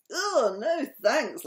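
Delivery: high, loud, drawn-out refusal; laughing refusal. A narrator's reading. Sam turns down Francine's kiss, laughing it off. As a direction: refuse with hammed-up amusement, slowly. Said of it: "laughed Sam".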